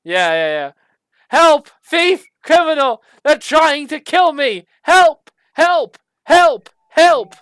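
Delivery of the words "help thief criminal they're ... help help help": alarm cries. Acted. Staff alarm keeps crying out.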